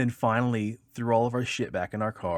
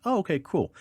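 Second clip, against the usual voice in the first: mock casual cop; 10 semitones higher, 5 dB louder than usual. Cop feigns ease.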